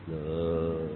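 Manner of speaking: normal talking voice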